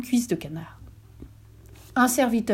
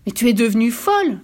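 a raised, angry outburst; angry cry; 4 semitones higher, 6 dB louder than usual. Acted. Old man crying out angrily at his wife. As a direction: cry out at her in anger. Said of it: "s'écria le vieil homme avec colère".

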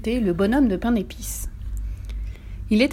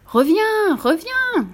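very high, urgent come-back cry; 12 semitones higher, 3 dB louder, much slower than usual. A narrator's reading. Old lady calls after the gingerbread man, high cry. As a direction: call after them at the top of the pitch range. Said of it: "la vieille dame criait".

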